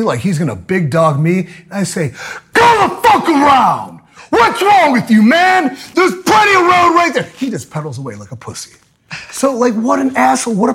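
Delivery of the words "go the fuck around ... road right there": furious high-pitched yell; shouting. Retold or imitated. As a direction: scream it across the road. Yelling at cyclist.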